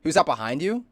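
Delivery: sudden loud startled question; pretending to spot someone behind; mock spooked. Mock paranoid moment while high.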